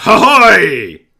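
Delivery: high, loud shouted reply; goblin guards' answering cry. Acted. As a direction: shout it back like a crowd of goblins. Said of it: "the other goblins call back".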